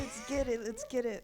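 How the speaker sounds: hyped up. Retold or imitated. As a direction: fire yourself up for the last rep. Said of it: "Just like".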